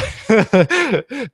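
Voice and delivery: high squealing giggle; real laugh. Real, not acted. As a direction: let a high giggle burst out. Laughing at own joke.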